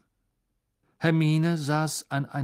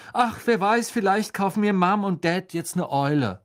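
high, louder hopeful wondering; lively musing; 8 semitones higher, 4 dB louder than usual. A narrator's reading. Character wonders about parents buying an owl, lively. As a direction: muse aloud, pitched up.

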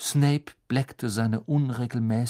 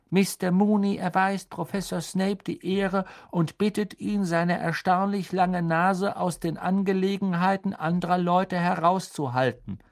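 higher, louder cheeky tone; mocking map voice; 8 semitones higher, 3 dB louder than usual. The map insulting Snape in a cheeky voice. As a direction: read it like a prank note.

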